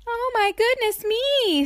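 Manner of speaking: high, squeaky little character voice; alarmed squeak. A narrator's reading. Tiny Miss Tiny meets a huge pig, alarmed squeak. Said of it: "squeaked little Miss Tiny in alarm".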